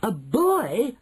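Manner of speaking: high witches' outcry; crying out. A narrator's reading. Witches hearing of a boy, high cry. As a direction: cry it out as a startled crowd. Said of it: "cried the witches".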